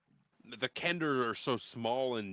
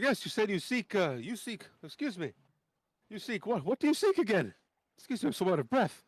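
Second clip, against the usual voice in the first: much higher, louder and winded; Dalamar voice; 11 semitones higher, 4 dB louder than usual. Acted. Dalamar quizzing the heroes mid-march, high winded voice. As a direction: gasp out the question, out of breath. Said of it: "he's like".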